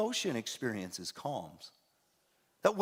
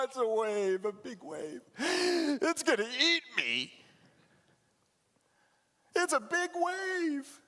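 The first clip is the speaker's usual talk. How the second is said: frightened fisherman; 10 semitones higher, slower than usual. Pretend. Frightened fisherman sees wave.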